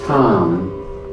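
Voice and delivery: normal talking voice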